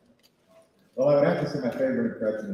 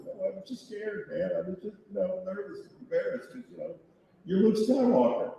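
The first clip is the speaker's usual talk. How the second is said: nervous apology; 9 semitones higher than usual. Retold or imitated. Explaining to Mark Hamill he was scared, meek and high. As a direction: apologise meekly, much higher. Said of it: "I was like".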